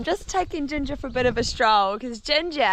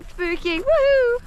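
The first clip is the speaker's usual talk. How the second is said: excited cheer; 9 semitones higher, 4 dB louder, slower than usual. Real, not acted. Cheering horse on over jump.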